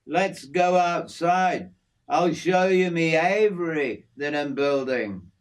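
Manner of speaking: easygoing outback mate showing off his aviary; hint of a broad Australian working man; Aussie bloke Jim. Jim, an Aussie bushman, talks.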